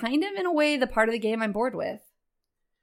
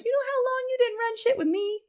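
exasperated; 12 semitones higher than usual. Real, not acted. Exasperated complaint at partner.

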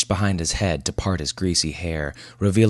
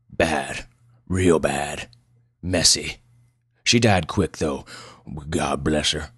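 put-on gruff old widower in short, broken phrases; gruff old man; 2 semitones lower, slower than usual. Old patient describes his wife's death, gruff country voice. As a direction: recall it haltingly in a rough country voice. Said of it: "Pleasanton's voice was as gruff as his appearance".

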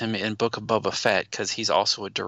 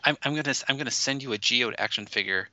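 ordinary talk, nothing marked

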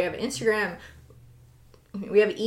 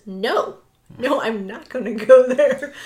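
louder, raised protest with a laugh; mock sibling refusal; 4 semitones higher, 9 dB louder, slower than usual. Imitating sister refusing to go alone, laughing protest. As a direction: playfully dig your heels in.

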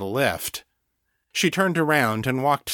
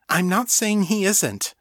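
high, hurried protest; said quickly; 9 semitones higher than usual. A narrator's reading. Rebecca heading off Danny's objection, said quickly. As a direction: jump in to placate her. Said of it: "Rebecca said quickly".

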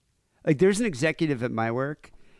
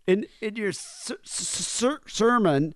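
stammering imitation; 7 semitones higher, slower than usual. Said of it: "He's just like".

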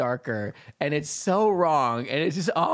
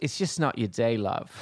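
offhand British man in a joke; deadpan put-on British delivery of a punchline; matter-of-fact mock British voice; British accent; 4 semitones lower than usual. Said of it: "gonna say it in a British accent".

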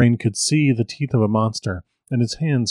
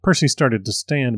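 usual speaking voice

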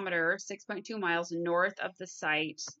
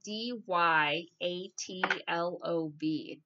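slowly spelling D Y A T L O V letter by letter; much slower than usual. Shown as a demonstration. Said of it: "So I'm gonna spell it for you".

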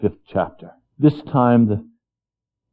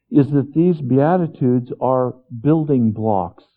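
ordinary talk, nothing marked

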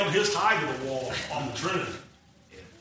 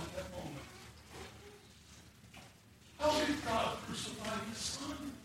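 tearful and quiet; 3 semitones higher, 12 dB quieter than usual. Real, not acted. Father confessing to his son, hushed and tearful. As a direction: tell it barely holding back tears. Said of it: "I started crying".

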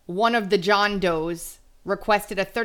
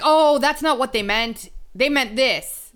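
higher, louder mock voice of an apologist; a put-on voice, higher and louder, making excuses for someone else's words; mocking excuse-maker; 6 semitones higher, 5 dB louder than usual. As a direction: sneer it like someone explaining away a gaffe. Mocking people excusing politicians.

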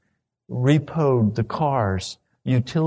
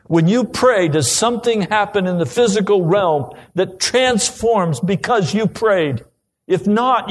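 higher, louder impassioned preaching; pleading sermon climax; 8 semitones higher, 4 dB louder than usual. Acted. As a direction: press the congregation with building urgency. Preaching at full force.

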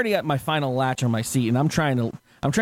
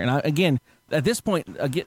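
usual speaking voice

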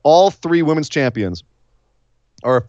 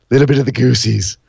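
playful mock voice. Shown as a demonstration. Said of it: "repeats in playful voice".

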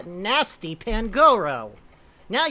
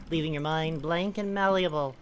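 ordinary talk

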